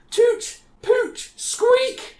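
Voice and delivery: very high, squeaky mock recorder toots; imitated recorder tooting. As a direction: squeal out bad recorder notes. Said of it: "they hear this noise going".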